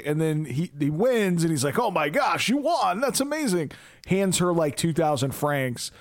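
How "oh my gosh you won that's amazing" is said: high-pitched gushing amazement, imitated; mock delight. Gambler congratulating her on the win, mock delight. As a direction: gush like a thrilled winner, playfully.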